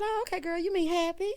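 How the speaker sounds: high-pitched, cheerfully puzzled grandma; confused grandma. Retold or imitated. Grandma mishearing Lauren's coming out, high and puzzled. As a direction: imitate a grandma who has misunderstood. Grandma misunderstanding, voiced in recap.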